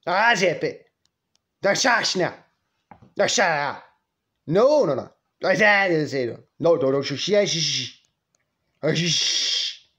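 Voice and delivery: mock-stern hushing in short bursts; scolding grandma. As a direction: imitate grandma cutting off all apologies. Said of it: "she'd be like".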